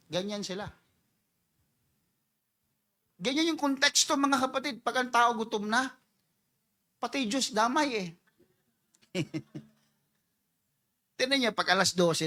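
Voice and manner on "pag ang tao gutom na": ordinary talk, nothing marked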